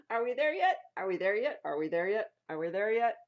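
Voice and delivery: loud, insistent whine; whining kids. Acted. Kids whining to parents from the back seat. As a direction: nag again and again.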